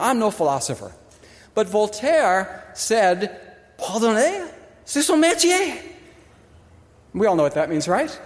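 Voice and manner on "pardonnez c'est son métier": lightly French-accented quote, rising. Shown as a demonstration. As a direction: savor the French line like a philosopher. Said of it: "sounds so much better in French".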